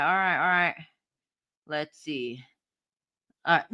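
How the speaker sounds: ordinary delivery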